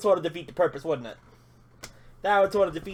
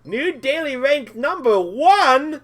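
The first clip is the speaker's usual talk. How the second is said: much higher, louder victory whoops, slowing on the big lines; ecstatic cheering; 10 semitones higher, 9 dB louder, slower than usual. Real, not acted. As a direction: shout with real joy at finally winning. Tops the daily rank.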